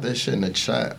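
ordinary talk

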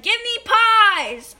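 loud, high, drawn-out demand; demanding shout. A narrator's reading. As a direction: call it out like a hungry kid. Hungry boy demands pies at contest.

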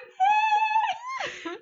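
very high, loud, stretched hello; mock nervous greeting. Calling the cat's owner with bad news, mock panicky greeting. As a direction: squeak out an overdone guilty greeting.

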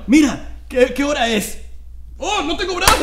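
silly victim voice. Pretend. Voicing the armless victim.

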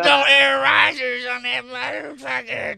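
put-on slurred, slow drunk shouting; loud drunk heckling. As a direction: yell like a drunk at the bar TV. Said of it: "some old drunk guy in the bar".

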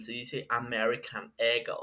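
mocking snooty "American Eagle" in English; mock English accent. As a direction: parody a know-it-all correcting "American Eagle". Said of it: "cómo se pronuncian bien las marcas".

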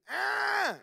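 sharp high imitated baby shriek; baby squeal. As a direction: squeal like a toddler with messy hands. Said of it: "you can't defeat".

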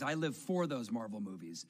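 ordinary talk

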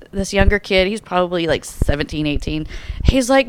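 ordinary talk

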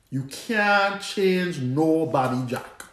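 put-on slow, drawn-out Antiguan accent. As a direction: lean into a Caribbean Antiguan lilt. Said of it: "in my Antiguan accent".

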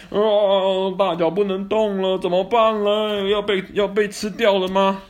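high, loud dramatic narration; suspenseful excitement. A narrator's reading. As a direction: build the suspense. Storyteller climax suspense.

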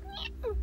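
very high mewing meow; a little cat's meow; kitten meow. Acted. Kitten chats with Teddy at night, high meow.

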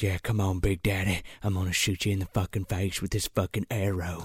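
low, hushed tough-guy impression; quiet mock taunt. Co-host imitating McConaughey daring the dragon, low hushed menace. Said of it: "he's like, he's like".

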